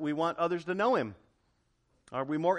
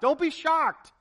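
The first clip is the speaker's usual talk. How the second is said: pitched way up and loud, pleading; urgent high exhortation; 11 semitones higher, 7 dB louder, slower than usual. Acted. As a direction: cry it out to the congregation. Preacher urging congregation.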